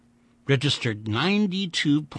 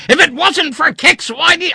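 angry shout; 13 dB louder than usual. A narrator's reading. Vandeleur keeps shouting, cut off.

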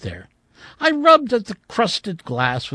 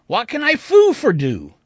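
higher, louder, bubbly and quick; eager garbled offer; 7 semitones higher, 7 dB louder than usual. Acted. Old shopkeeper eagerly greeting a customer, garbled. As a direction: trip over the words in eagerness.

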